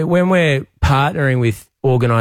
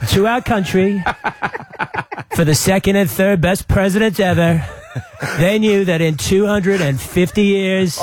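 higher, slow, drawn-out Trump voice; Trump impression; 6 semitones higher than usual. Premier greets the radio host as Trump, high and slow. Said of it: "your Trump impersonation".

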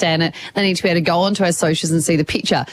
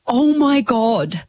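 drawn-out mock-horrified reaction; retold shock; 2 semitones higher, much slower than usual. Host retells seeing her butt, re-enacted shock. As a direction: stretch it out slowly as a re-enacted gasp of dismay.

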